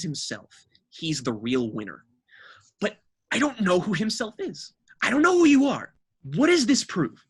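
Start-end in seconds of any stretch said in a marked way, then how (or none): 2.8-7.2 higher and louder, pushing into disbelief; exasperated outburst. Real, not acted. Ranting about the rapper's identity, voice climbing loud and high. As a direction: demand an answer he knows won't come.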